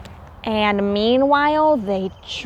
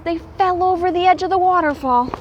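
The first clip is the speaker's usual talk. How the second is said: rushed; 4 semitones higher, much faster than usual. A narrator's reading. Dramatic rush of the fall.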